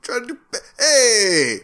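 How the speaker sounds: high jokey Brooklyn outburst; Brooklyn accent. Retold or imitated. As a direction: burst out with a mock Brooklyn hey. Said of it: "ridiculous Brooklyn accent".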